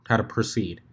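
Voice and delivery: plain reading voice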